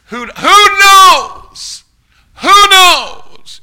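high, loud, slow drawn-out cry; preacher's shout. Real, not acted. Preacher to congregation, a long, soaring shout. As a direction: hurl the question at the whole room.

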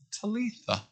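soft Talitha. Shown as a demonstration. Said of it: "It's a beautiful name".

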